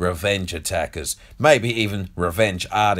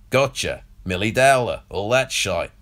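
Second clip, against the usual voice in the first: ordinary delivery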